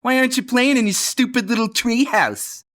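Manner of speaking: high, loud mocking bully voice; taunting bully. Billy mocks Johnny about his treehouse, high and jeering. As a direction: jeer at the little kid.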